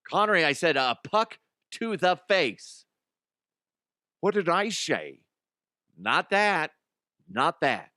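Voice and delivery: exasperated, pitch swooping up; mock exasperation. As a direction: scold a mishearing sidekick in mock outrage. Mock scolding in Connery bit.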